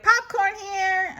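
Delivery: high, loud copycat yell; Eloise yelling. Acted. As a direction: shout it back like the vendor. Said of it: "I yell".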